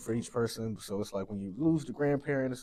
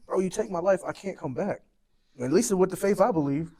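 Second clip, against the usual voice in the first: louder, higher, pleading rant; passionate rant; 7 semitones higher, 5 dB louder than usual. Real, not acted. Mourning friend rants to host about the community, louder and higher. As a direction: let the frustration pour out. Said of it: "and this is a little rant".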